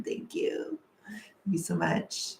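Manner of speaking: soft breathy high whisper; whispered thanks. Real, not acted. Vlogger whispers thanks to viewer Goblin, soft.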